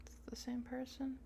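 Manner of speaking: a breathy unvoiced whisper; hushed question to yourself. Real, not acted. Reading quietly to self.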